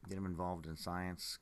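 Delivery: ordinary talk, nothing marked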